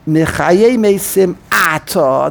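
slow "Mechaye Mesim Ata" with a pause before "Ata"; read with the mesek. Shown as a demonstration. Rabbi showing the Art Scroll reading, slow and separated. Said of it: "Who told them to put a mesek".

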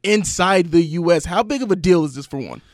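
loud, energetic radio host intro. Acted. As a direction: welcome listeners back with big energy. Host opens segment on air.

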